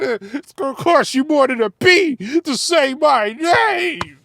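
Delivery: loud, slow, high mocking yell at the lyric; mock outraged yell. Reacting to a rap lyric, mock outraged yell. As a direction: shout the line back in mock outrage.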